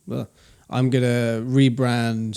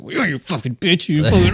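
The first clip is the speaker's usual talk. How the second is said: hammed-up angry inner voice, higher and louder; harsh self-scolding; 5 semitones higher, 5 dB louder than usual. Man acting out how he talks to himself, harsh mock rage. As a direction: berate yourself in mock fury. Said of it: "to myself becomes more harsher".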